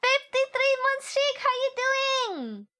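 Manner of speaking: sing-song welcome to a subscriber; hammed-up host voice; high greeter voice.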